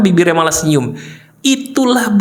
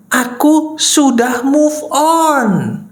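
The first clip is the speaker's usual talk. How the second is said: very slow, higher-pitched declaration; drawn-out, pitched-up demonstration; model affirmation; 6 semitones higher, much slower than usual. Shown as a demonstration. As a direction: stretch it out and hold the last word. Demonstrating the right self-statement.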